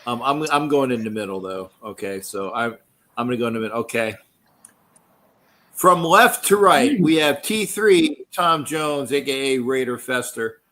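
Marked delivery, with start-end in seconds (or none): 5.7-10.6 announcer intro. Acted. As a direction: introduce them like a ringside announcer.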